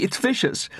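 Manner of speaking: high, frightened warning; alarmed cry. A narrator's reading. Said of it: "cried Mary".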